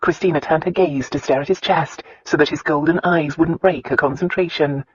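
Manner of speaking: plain reading voice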